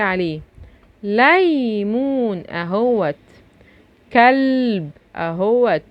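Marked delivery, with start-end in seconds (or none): 1.0-2.4 ليمون said slowly with both syllables stretched; drawn-out demonstration. Shown as a demonstration. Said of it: "ولما تسمع حرف اللام في الكلمة". 4.1-4.9 كلب said slowly, leaning on the L; sounded-out L. Shown as a demonstration. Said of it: "ولما تسمع حرف اللام في الكلمة".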